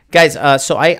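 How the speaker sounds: ordinary talk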